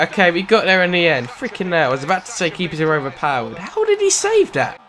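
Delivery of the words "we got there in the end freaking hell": ordinary delivery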